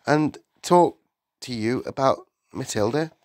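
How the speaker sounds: earnest teacher asking for a word; polite Miss Honey. A narrator's reading. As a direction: apologise politely but press the point.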